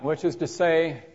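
usual speaking voice

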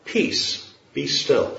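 hushed and soft, near a whisper; Jesus calming the sea. Acted. Jesus speaks to the waves, hushed and calm. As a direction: still the storm with a soft command. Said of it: "he calmed the sea with his words by saying".

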